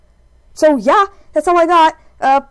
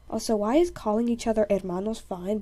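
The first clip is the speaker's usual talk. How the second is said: ordinary talk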